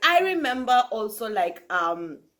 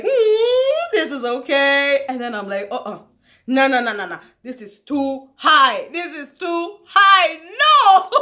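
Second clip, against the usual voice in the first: mock ride panic; 4 semitones higher, 8 dB louder, slower than usual. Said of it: "I was like".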